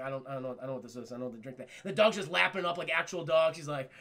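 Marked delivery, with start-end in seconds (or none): 0.0-1.7 low, hushed, uncertain mumble; baffled muttering. Acted. Jack staring at a strange drink, quietly baffled. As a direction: murmur it low, at a loss.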